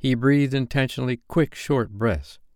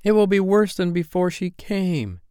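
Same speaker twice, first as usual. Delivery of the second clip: put-on high-pitched childish protest; mildly menacing child's outburst; threatening cry; 9 semitones higher than usual. A narrator's reading. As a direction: cry it out as panicky, threatening boys. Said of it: "advanced upon her threateningly".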